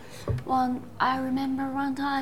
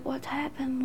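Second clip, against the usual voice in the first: whispering; 4 semitones higher, 10 dB quieter than usual. Retold or imitated. Asking friend quietly at party.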